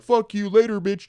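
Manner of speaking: loud, high mock yell; retold taunting scream; screaming out window. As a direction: holler the taunt to a buddy down the street. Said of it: "like, screaming at him".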